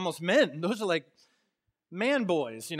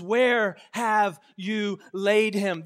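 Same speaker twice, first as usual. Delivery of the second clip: intense demand; 8 dB louder, much slower than usual. Acted. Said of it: "not just a simple inquiry".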